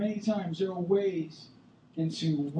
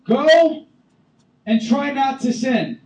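high loud go, then slow shrug; mock lenient Jesus, high and loud; mock misquote; 10 semitones higher, 14 dB louder, slower than usual. Shown as a demonstration. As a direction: act out a lame version. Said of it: "I don't know".

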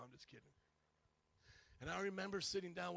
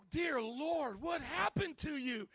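alarmed disbelief; 8 semitones higher, 6 dB louder, slower than usual. Retold or imitated. Retold alarm at the scream.

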